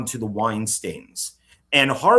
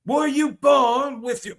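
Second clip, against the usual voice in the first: put-on high, slow botched Gandalf accent; a clumsy attempt at a wizard's accent; half-hearted mock accent; bad accent; 12 semitones higher, 4 dB louder, slower than usual. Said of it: "that was a horrible accent".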